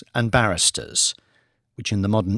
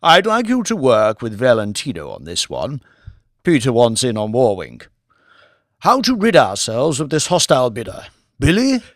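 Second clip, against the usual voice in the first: a louder, commanding lordly voice; Lord Martin; 4 dB louder than usual. Acted. Lord briefing his bankers on the Warwink bid, commanding. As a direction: run the meeting like a peer who owns the room.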